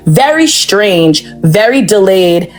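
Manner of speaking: slow, drawn-out mocking words; sarcastic slow-motion delivery. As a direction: spell it out slowly as if to a slow child. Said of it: "I'm gonna be very slow with you guys".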